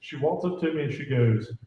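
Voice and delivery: normal talking voice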